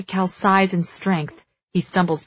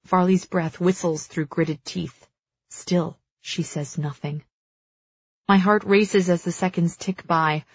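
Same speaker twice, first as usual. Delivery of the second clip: ordinary delivery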